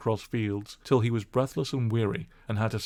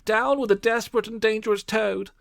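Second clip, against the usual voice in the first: high, louder outraged gentlemen; angry cry; 13 semitones higher, 3 dB louder than usual. Acted. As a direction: rage at the thief. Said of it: "they cried".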